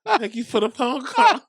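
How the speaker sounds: laughing retelling. Real, not acted. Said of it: "good McConaughey voice".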